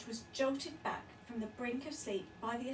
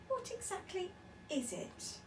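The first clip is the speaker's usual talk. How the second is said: nervous; 7 semitones higher, 4 dB quieter than usual. A narrator's reading. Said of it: "asked Missus Large nervously".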